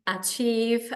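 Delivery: normal talking voice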